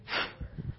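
a long, tired, exasperated sigh; weary sigh. Shown as a demonstration. Pastor acting fed up for the congregation, weary sigh. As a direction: breathe out like you're fed up. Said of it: "when I say it that way".

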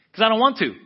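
high, loud defiant shout; angry outburst. Retold or imitated. Man refusing God's demand to forgive, angry outburst. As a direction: burst out with a stubborn refusal.

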